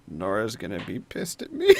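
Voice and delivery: low, soft mutter rising at the end; muttered under breath. Acted. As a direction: mutter it to yourself, half amused. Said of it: "Mila mutter under Al's breath".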